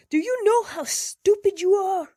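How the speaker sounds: high, loud furious holler. A narrator's reading. Jackson cornered by his bed, hollering at the narrator. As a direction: yell it at the intruder. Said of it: "he hollered".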